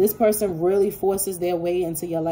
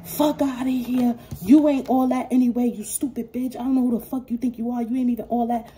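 higher, louder spiteful rant; mock bitter outburst; 6 semitones higher, 3 dB louder than usual. Imitating a rejected person lashing out, higher and louder. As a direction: spit the insults back like a scorned ex. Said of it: "This person is gonna be like".

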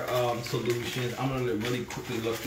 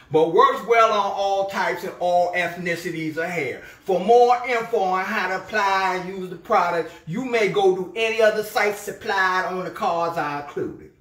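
a louder, announcing lady voice; new letter-writer voice; 6 semitones higher, 7 dB louder than usual. Acted. Reading a viewer's hair-product letter aloud, in a louder announcing lady voice. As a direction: read it like she's about to tell people all. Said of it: "that's probably closer".

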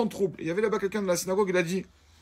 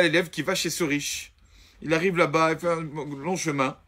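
normal talking voice

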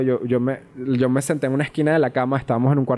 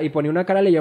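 usual speaking voice

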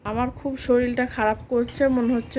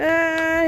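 pained ouch; 5 semitones higher, 4 dB louder than usual. Pretend. Injection given in pretend play.

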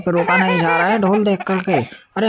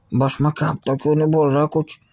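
ordinary talk, nothing marked

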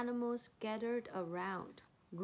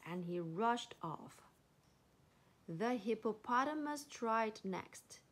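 usual speaking voice

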